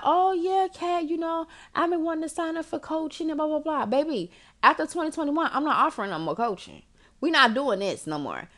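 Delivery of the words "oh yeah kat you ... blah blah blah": higher, gushing impression of a client; mock eager client. Imitating clients begging the coach for a slot, mock eager. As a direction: lightly play the girls who keep asking. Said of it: "y'all be like".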